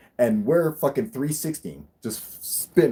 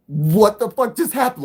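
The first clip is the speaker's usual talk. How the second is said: much higher, loud, incredulous yell; acted-out astonished outburst; stunned disbelief; 11 semitones higher, 8 dB louder than usual. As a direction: re-enact shock at surviving a near crash. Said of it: "we could not believe it".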